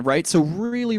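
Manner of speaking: normal talking voice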